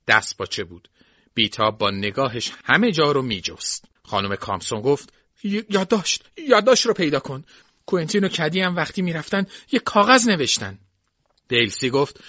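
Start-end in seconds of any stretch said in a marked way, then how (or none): 5.4-10.8 high, stammering urgent demand; stumble, then push it out high and loud; frantic mistress. A narrator's reading. Mistress orders servant to find the farewell note, frantic.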